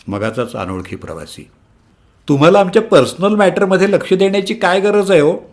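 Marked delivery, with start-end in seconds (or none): 2.3-5.4 high, lofty put-down; haughty. Acted. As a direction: talk down to the stranger. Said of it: "मोठ्या रुबाबात".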